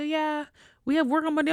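high, airy imitation of a clueless coworker; mocking coworkers. Mimicking coworkers who think Monday is a workday. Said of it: "some folks like".

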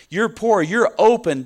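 preacher punching two short claims; emphatic preaching. Real, not acted. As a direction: land it hard on the congregation.

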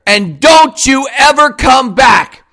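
ordinary delivery

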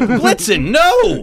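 loud, high shout of reproach; hammed-up outraged cry; mock scolding. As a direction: yell at a misbehaving reindeer. Scolding the imagined reindeer.

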